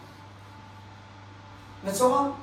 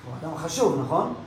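ordinary delivery

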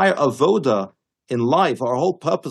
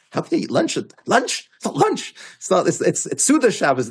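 incredulous objection; 7 semitones higher than usual. Retold or imitated. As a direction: pitch leaping up on an indignant "lunch". Acting out the rabbi's indignant objection.

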